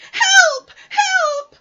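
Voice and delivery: shrieking, very high cries for help; panicked Piglet. A narrator's reading. Piglet seeing the Heffalump, panicked high shriek. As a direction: squeal at the top of your voice. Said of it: "cried Piglet".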